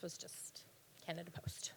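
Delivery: exasperated whispered laugh; whispered giggle at a sore point. Real, not acted.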